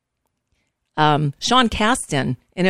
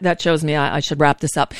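normal talking voice

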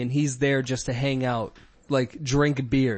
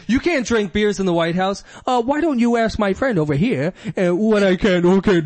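louder, higher smug taunt; cocky comeback, laughing at the end; smug guard; 6 semitones higher, 5 dB louder than usual. Acted. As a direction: lord it over the guard. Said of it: "acted movie scene".

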